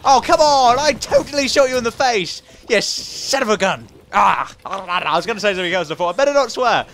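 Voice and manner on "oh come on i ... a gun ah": loud, higher protest; frustrated outburst. Real, not acted. Gamer protesting he shot an enemy in the face. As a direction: complain you were robbed.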